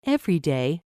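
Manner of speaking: ordinary delivery, nothing marked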